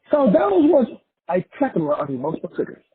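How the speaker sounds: usual speaking voice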